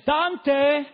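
high, loud call of the name Dante; Alberto Motesi impression. Preacher mimicking Motesi calling his name Dante. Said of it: "Porque él habla así".

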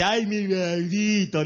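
put-on slow, cooing mother's baby talk; doting mother. Mother fondly cooing at her son, slow and sweet. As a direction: coo it like a fond mom. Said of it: "No es que le dice".

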